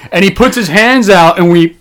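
ordinary talk

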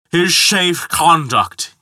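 put-on slow, high, slurred drunk; drunken burble. A narrator's reading. Tipsy giant excusing himself to Thor, burbling. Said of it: "he burbled".

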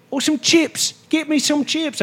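high mock British accent. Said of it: "British accents".

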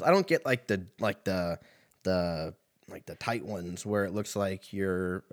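ordinary talk, nothing marked

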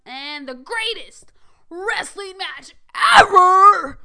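slow, loud billing bursting into a shout on "ever"; mock announcer hype. Podcaster hyping Backlash match to listeners, mock announcer hype. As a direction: build to a huge hammed-up yell.

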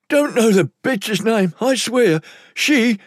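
high-pitched, panicky captive voice; frightened lowlife. Acted. Captive pleading with his interrogators, high and frightened. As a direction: protest your innocence in a high voice. Captive answers under threat.

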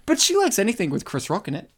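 ordinary talk

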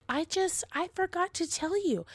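put-on high, small kid voice; high-pitched retold excuse; sheepish child. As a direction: imitate a child making excuses. Imitates child answering panicked mother.

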